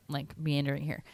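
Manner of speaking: usual speaking voice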